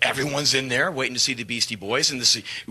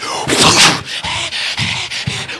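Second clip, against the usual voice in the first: sharp gasp; 3 semitones higher, 5 dB louder than usual. Retold or imitated. Iggy's feral stare.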